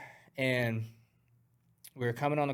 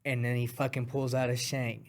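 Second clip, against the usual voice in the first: normal talking voice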